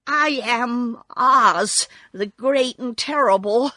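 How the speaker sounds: trembling. A narrator's reading. Little man naming himself to attackers, trembling. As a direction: announce the title with a touch of fright. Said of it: "in a trembling voice".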